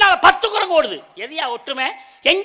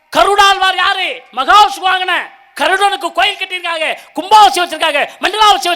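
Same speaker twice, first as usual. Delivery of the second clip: higher and louder, near shouting; fiery stage shout; shouted challenge; 4 semitones higher, 7 dB louder than usual. Real, not acted. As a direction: shout the question at the opponent. Debater shouting his argument.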